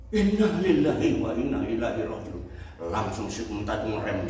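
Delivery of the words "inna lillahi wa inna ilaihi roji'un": "Inna lillahi wa inna ilaihi roji'un" blurted louder and higher; hurried cry. Retold or imitated. Traveler crying out the phrase to stop at the cliff, urgent voice. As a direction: call it out desperately.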